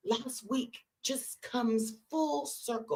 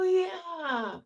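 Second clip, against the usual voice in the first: high, drawn-out retold reply; emphatic confirmation; 8 semitones higher, 3 dB louder than usual. Teller confirming the shared name to her friend, emphatic. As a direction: confirm it with mock amazement.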